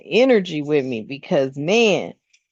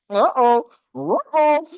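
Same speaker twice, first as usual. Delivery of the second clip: playful uh-oh; 9 semitones higher, 4 dB louder, slower than usual. Real, not acted. Startled by the chills.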